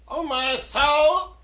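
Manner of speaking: high, loud, drawn-out "Oh, my soul!" as the pot boils over; startled cry. Real, not acted. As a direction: caught off guard, slow and loud.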